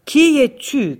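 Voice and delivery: high, loud furious demand; angry shout. Acted. Tsar confronting the captured thief, furious shout. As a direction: bark the question at the thief. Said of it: "cria le tsar, très en colère".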